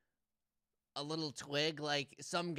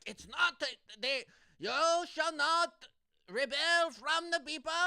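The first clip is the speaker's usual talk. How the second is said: high, loud, hammed-up German orator; German accent; 11 semitones higher, 8 dB louder than usual. Philosopher scolding about the French republic, loud German accent. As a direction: declaim it in a mock German accent. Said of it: "it would probably be more German".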